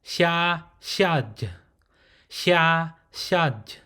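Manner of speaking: xia open, xiaj closed with a falling end; xia then xiaj. Shown as a demonstration. Teacher modelling the open and closed syllable, clear and slow. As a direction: contrast xia with xiaj, drop the pitch on xiaj. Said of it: "that's how you pronounce the consonant".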